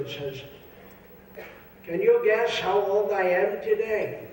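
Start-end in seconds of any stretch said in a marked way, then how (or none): 1.9-4.3 put-on old man asking. Old man asks friend on bench.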